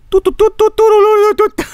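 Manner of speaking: high, loud toot toot like a little horn. Pretend. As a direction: toot it out like a toy train horn. Imitating a horn noise.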